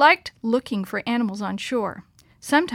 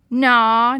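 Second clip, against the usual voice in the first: lightly drawled, louder naw; 9 dB louder than usual. A narrator's reading. Guide dismisses the idea of slimy snakes, drawling. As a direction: stretch the word in a country drawl. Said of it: "Jake drawled".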